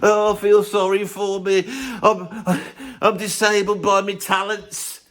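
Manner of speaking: mock a self-pitying goth star; hammed-up poor-me moan. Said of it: "Oh, Edward Scissorhands".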